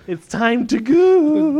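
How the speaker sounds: slow, loud, high "goo"; drawn-out goo. Acted. Host hyping the boys by stretching goo, loud and slow.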